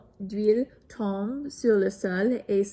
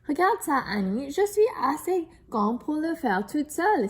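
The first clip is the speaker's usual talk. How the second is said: excited exclaim; 6 semitones higher, 3 dB louder than usual. A narrator's reading. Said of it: "s'exclame Eric".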